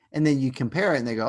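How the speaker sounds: ordinary talk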